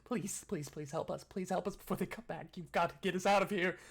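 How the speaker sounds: desperate plea building from high begging to loud cries; imploring. Acted. Chained captive begging Kashak to free them, frantic imploring. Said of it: "imploring at you".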